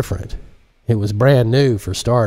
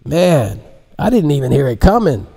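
put-on young man voice; 5 semitones higher, 3 dB louder than usual. Jacinto speaks in story.

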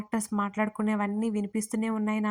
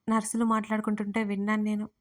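ordinary delivery

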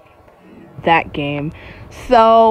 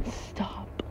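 tiny whispered plea; very soft, breath-only; frightened whisper; 21 dB quieter than usual. Real, not acted. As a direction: whisper it after a scare. After a gasp at shaking ground.

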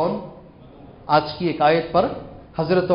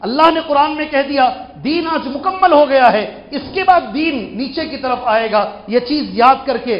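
high, loud proclamation; raised-voice sermon emphasis; forceful preaching; 7 semitones higher, 5 dB louder than usual. Real, not acted. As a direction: drive the point home to the congregation. Preacher drives point home.

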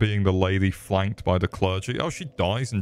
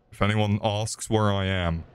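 ordinary talk, nothing marked

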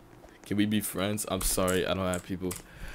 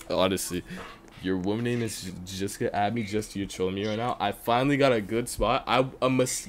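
ordinary delivery

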